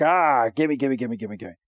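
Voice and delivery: lightly voiced, low greedy gimme-gimme; quiet, rapid grabbing chant tailing away; mock grabby squabbling. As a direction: play-act squabbling over a toy. Voicing squabbling Pokemon over a ball.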